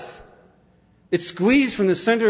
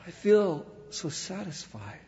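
suddenly soft, fading last words; acted dying contentment; 3 semitones higher, 9 dB quieter than usual. Preacher voices Brewster's last words, softly fading. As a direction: let it sink into a peaceful final breath.

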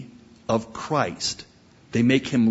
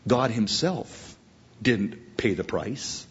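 usual speaking voice

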